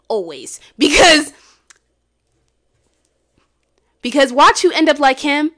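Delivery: high, loud mock sermon to the children; preachy. Lecturing viewers about simping, mock preaching. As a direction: preach it like a fired-up auntie.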